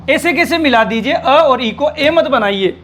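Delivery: ordinary talk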